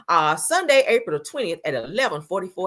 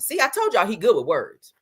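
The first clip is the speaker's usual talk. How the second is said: ordinary talk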